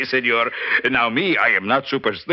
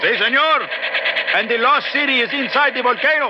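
put-on shouting over engine, Mexican accent; 13 semitones higher than usual. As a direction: point it out at full voice. Shouting over helicopter noise.

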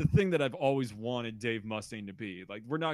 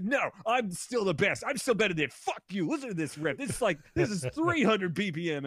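much higher, louder frantic bragging; 8 semitones higher, 5 dB louder than usual. Retold or imitated. [name] insisting he's still the best, higher frantic mock brag. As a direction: rave like someone whose brain just snapped. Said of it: "he's just like".

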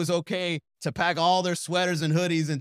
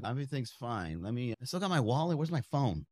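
suspicious mutter; 3 semitones lower, 6 dB quieter than usual. Retold or imitated. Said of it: "I was just like".

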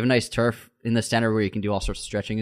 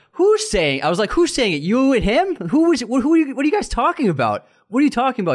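retold outrage, pushing back hard; heated demand; 13 semitones higher, 5 dB louder than usual. To the gym guy, demanding who said it: indignant loud questions. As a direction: voice jumps high and loud, demanding answers.

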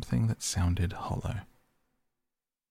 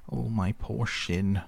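drawn-out, mildly pained moan; slow acted lament; pained cry; 3 semitones higher, slower than usual. A narrator's reading. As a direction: wail slowly over a sore shin. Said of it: "he cried".

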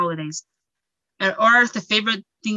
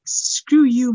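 defiant screw you; 5 semitones higher, 6 dB louder than usual. Retold or imitated. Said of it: "then I'm just like".

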